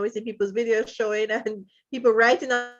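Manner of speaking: ordinary talk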